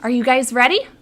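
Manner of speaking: ordinary delivery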